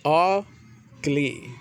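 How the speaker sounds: "Ugly" slowly split as ug... lee; syllable demonstration. Shown as a demonstration. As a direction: pause inside "Ugly", long ee at the end. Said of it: "the sound E and in Y".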